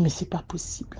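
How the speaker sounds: stunned whisper; whispered disbelief to her mother. Retold or imitated. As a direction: breathe it out in disbelief. Shocked reply to the mother.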